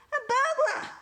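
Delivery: very high, loud shout of alarm. A narrator's reading. Dad finding a burglar in the kitchen, shouting. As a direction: yell it in shock. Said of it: "ran down to the kitchen and shouted".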